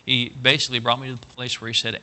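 normal talking voice

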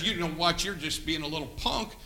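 quiet sneering put-down; scornful brother. Retold or imitated. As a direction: sneer it low and dismissive.

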